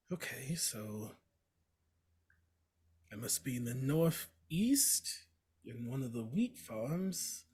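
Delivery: slow, hushed thinking aloud; soft, half-whispered in-character musing; quiet musing. Acted. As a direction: murmur it slowly, working out where you are. Player voices character looking around.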